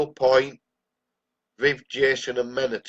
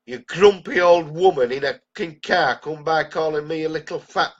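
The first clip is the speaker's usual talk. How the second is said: louder, higher furious tirade; angry rant; 5 semitones higher, 5 dB louder than usual. Real, not acted. Vlogger to politician over the old woman's insult, raging shout. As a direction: really lay into him, voice rising.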